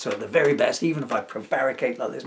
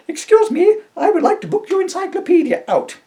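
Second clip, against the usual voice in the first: put-on high, loud fluttery old lady voice; a fussy lady booking a person like a book; 8 dB louder than usual.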